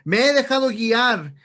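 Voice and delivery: loud, forceful exhortation; urgent, ringing pulpit call; fiery preaching. Real, not acted. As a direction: preach it at full force to the congregation. Preaches confession at full force.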